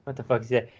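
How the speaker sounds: ordinary talk, nothing marked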